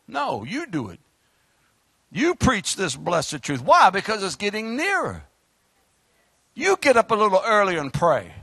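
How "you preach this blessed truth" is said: usual speaking voice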